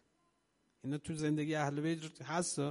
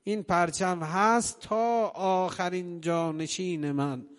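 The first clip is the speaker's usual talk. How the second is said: preaching at full force; 6 dB louder than usual. Acted. Preacher voices Sajjad's prophecy to the mourners, impassioned. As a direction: declaim the prophecy with fervor.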